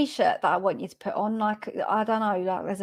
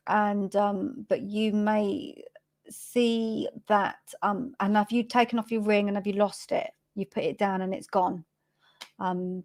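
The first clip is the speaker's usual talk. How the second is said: ordinary talk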